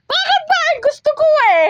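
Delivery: sassy high voice. Pretend. High put-on sassy reply.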